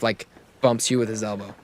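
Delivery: usual speaking voice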